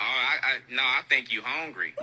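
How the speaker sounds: normal talking voice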